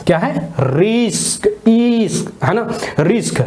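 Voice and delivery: रिस्क drawn out slowly, with ईस्क split off to show the short ई; slow sounded-out word. Shown as a demonstration. Teacher to class, slowly breaking down the word रिस्क. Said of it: "आई को यहाँ क्या पढ़ रहे हैं, ई पढ़ रहे हैं".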